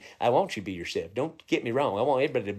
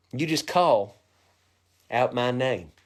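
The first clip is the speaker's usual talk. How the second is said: normal talking voice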